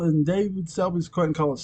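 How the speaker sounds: normal talking voice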